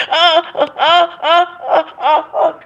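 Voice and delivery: high, strained moans; imitating a struggling niece. Mimicking the sick niece trying to talk. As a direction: mimic her trying to speak. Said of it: "She said".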